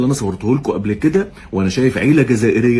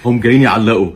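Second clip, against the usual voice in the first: ordinary talk, nothing marked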